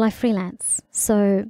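ordinary talk, nothing marked

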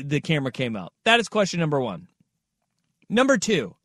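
ordinary talk, nothing marked

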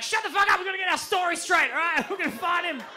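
yelling to get the story straight; panicked shout. Retold or imitated. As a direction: shout him down to take charge. Calming panicking friend.

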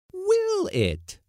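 very high, loud challenge with falling end; loud demand. A narrator's reading. Cook challenging the skipper, loud high demand. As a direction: shoot it back loudly, pitched right up, dropping at the end. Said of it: "demanded Missus Blossom loudly".

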